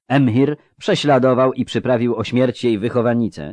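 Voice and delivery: ordinary delivery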